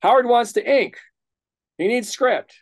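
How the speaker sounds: imitating the daily yell at Archie; mock yell. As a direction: yell it at a late writer, half in fun. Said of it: "yell at Archie".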